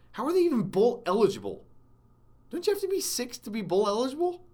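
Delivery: high-pitched, real disbelief; incredulous. Real, not acted. Sports host questioning a team's bowl eligibility, incredulous. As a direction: question it in high-voiced astonishment.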